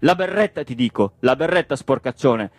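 exasperated, angry ordering; furious demand. Acted. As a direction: demand it while shaking the culprit. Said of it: "e lo scrollò furiosamente".

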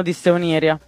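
plain reading voice

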